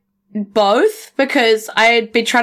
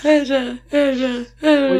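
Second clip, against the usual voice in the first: digital echo; 4 semitones higher than usual. Acted. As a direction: echo the word back fading. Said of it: "Love it".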